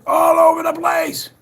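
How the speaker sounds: high, drawn-out yell; exasperated shout. Real, not acted. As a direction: belt it out, stretching the words. Exasperated outburst about the evidence.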